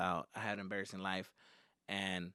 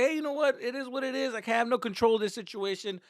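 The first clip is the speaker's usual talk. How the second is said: high, loud, hammed-up carefree pep talk; carefree acceptance; 11 semitones higher, 6 dB louder than usual. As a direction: shrug it off in a raised voice. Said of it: "just be like".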